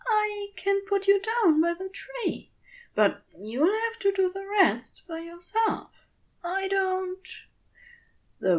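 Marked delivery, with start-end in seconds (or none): 0.1-7.3 higher, gentle voice for a tiny creature; soft reassurance. Acted. Aziraphale promising the creature help, soft and gentle. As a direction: reassure it kindly, then falter. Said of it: "He keeps his voice as soft as he can".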